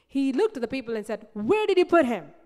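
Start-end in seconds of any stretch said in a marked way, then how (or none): 1.3-2.3 commanding demand. Acted. Jesus demanding to see the tomb.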